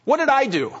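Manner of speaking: high, startled what did I do; husband startled by his wife's look; innocent surprise. Retold or imitated. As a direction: play the clueless husband caught out. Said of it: "I'm like".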